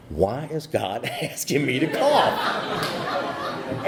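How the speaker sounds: slow, laughing through the question; puzzled, chuckling aloud. Real, not acted. Retelling funny confusion, laughs.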